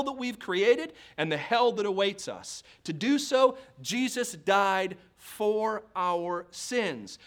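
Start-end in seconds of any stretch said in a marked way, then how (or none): 3.8-7.2 very slow, "for", "our" and "sins" each set apart; solemn, drawn-out preaching, weight on "for"; deliberate emphasis. Real, not acted. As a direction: space out "for our sins" word by word. Preacher hammers the verse word by word.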